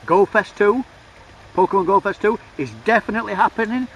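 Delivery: excited, loud, high-pitched announcement; hyped. Real, not acted. Vlogger announces Go Fest two to viewers, hyped. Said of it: "and I'm hyped".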